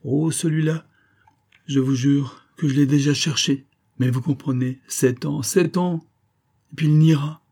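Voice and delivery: high, agitated pleading; dying woman, frantic and helpless; anguished dying woman. A narrator's reading. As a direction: insist desperately, voice rising.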